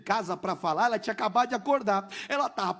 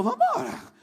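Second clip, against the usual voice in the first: eager yes; slower than usual. Retold or imitated. Wife's eager agreement.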